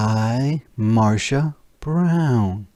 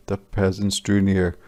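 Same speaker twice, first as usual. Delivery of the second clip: ordinary delivery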